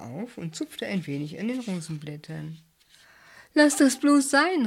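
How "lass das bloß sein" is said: high, loud, slow cry of alarm; startled angry shout. A narrator's reading. Julius stops Bert touching the rose, alarmed angry shout. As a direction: shout in fright and anger. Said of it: "rief Julius erschrocken und erbost".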